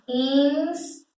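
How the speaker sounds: "Instru-" in drawn-out beats, ins, tru; syllable by syllable. Shown as a demonstration. Teacher starting to split the verb, syllable by syllable. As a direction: stretch each syllable of "Instru-" separately. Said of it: "O verbo aqui".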